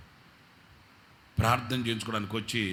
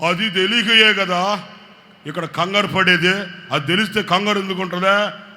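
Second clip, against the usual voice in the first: irritated retort; 6 semitones higher, 11 dB louder than usual. Retold or imitated. Husband snapping at the pastor, louder and irritated. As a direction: bite back like he might hit you. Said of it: "కొట్టేటట్టున్నాడు".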